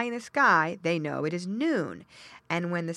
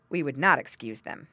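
usual speaking voice

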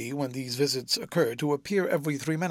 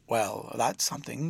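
half grumbling; 5 semitones lower, 3 dB quieter than usual. A narrator's reading. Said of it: "half grumbling".

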